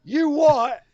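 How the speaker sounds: high, loud mock outrage; mock Pete Dunne. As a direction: hit it like a baffled brawler. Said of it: "Pete Dunne comes out ... to say".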